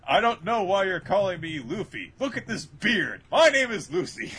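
high, loud hammed-up denial; acted indignant denial. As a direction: play a badly disguised man insisting. Luffy denying who he is.

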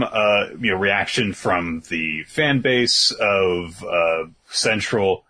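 ordinary talk, nothing marked

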